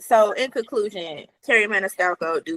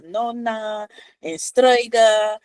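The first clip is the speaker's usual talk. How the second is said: put-on Italian accent; 2 semitones higher, much slower than usual. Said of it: "in an Italian accent".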